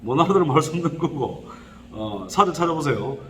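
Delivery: ordinary talk, nothing marked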